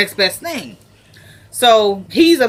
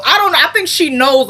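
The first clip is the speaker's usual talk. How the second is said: high, louder burst of commentary; excited comment; 8 semitones higher, 5 dB louder than usual. Real, not acted. Vlogger reacting to the scene, high and louder. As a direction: jump in all worked up.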